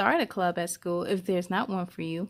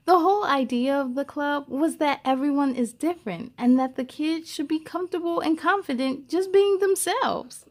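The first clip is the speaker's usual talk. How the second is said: gentle, expressive storybook reading; lively read-aloud for young listeners; reading to children; 3 semitones higher than usual. Acted. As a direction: read it like a picture book to a class. Reads a picture book aloud.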